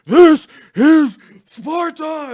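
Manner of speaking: huge, slow, high roared This is Sparta; drawn-out roaring This is Sparta imitation; Leonidas battle cry. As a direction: bellow it like a king rallying his army. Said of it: "Leonidas turns around and goes".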